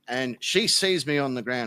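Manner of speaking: usual speaking voice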